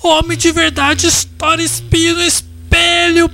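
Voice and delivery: macho rant. Acted. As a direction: rant like a tough guy.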